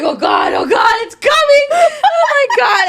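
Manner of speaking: mock panic. Retelling nausea on the plane, shrieking mock panic. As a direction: shriek it like the puke is coming up. Retold airplane vomit panic.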